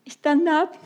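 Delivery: ordinary talk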